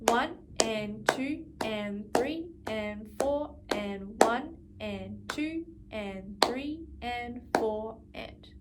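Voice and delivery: steady, slow beat counting; slow counting along. Shown as a demonstration. Teacher counting aloud while students play, slow and steady. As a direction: count slowly and evenly beneath the playing. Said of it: "Let's try the first two bars together".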